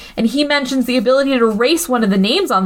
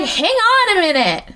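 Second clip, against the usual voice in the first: higher, exasperated mock voice of an impatient woman; impatient caller; 6 semitones higher than usual. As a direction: hurry a friend who won't pick up.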